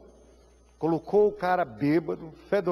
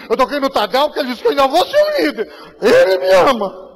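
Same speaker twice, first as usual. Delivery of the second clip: laughing through tears; 7 semitones higher, 10 dB louder than usual. Acted. Marcelo's tearful joy, pastor's belief.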